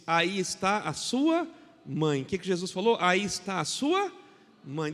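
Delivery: drawn-out, loud verse reading; slow scripture emphasis. Acted. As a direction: linger on each word of the verse. (leitura lenta e enfática do versículo)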